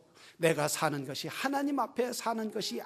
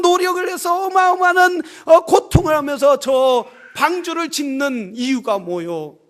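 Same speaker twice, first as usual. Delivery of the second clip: accusing, critical; 7 semitones higher, 11 dB louder than usual. Acted. Townspeople berating Noah over the costly ark, accusing. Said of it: "노아를 향하여 사람들은 비난하며".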